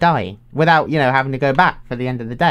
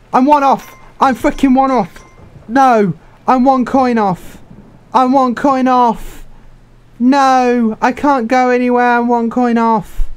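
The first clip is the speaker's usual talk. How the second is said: exasperated wail; 8 semitones higher, 3 dB louder, slower than usual. Real, not acted. One coin short, can't leave.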